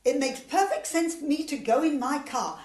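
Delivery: loud and pushy; pushy insistence. Acted. Competing member claims the job, loud and pushy. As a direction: insist loudly on going.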